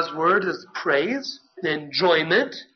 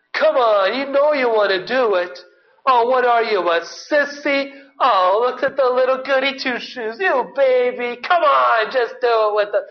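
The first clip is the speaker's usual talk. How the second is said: louder, higher jeering peer-pressure voice; mocking taunt; 5 semitones higher, 4 dB louder than usual. Guys huddled around pressuring him to join, jeering taunt. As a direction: goad him like a schoolyard bully. Peers taunting the psalmist to sin.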